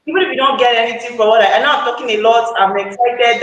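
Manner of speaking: normal talking voice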